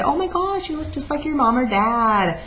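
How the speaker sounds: high-pitched mimicry of people exclaiming; gushing onlooker. Teacher quoting what people say about babies, mock gushing. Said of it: "a lot of times people say".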